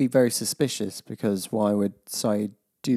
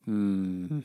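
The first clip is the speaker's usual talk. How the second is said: ordinary talk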